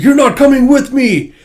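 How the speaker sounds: high and loud; firm shout. Retold or imitated. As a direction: shout them down. Damien shouting at lackeys.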